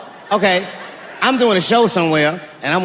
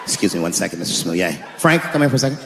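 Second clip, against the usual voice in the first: much lower, tired cop voice; weary police veteran; 13 semitones lower, 6 dB quieter, faster than usual. Acted. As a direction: sigh through the report like a jaded officer. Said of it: "police veteran".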